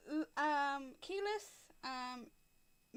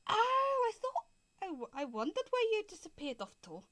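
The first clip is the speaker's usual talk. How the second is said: dwarf; 8 semitones higher, 5 dB louder than usual. Acted. Dwarf shopkeeper spots the missing little men, surprised dwarf. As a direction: start loud and high, then ease off as a dwarf. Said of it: "the dwarf kind of at this point kind of leans over and goes".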